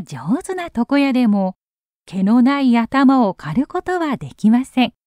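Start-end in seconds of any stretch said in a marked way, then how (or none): none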